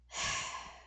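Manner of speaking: long breathy in-character sigh; exasperated exhale; weary sigh. Acted. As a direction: let out a long, resigned sigh. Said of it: "Lord Mazaroth sighs".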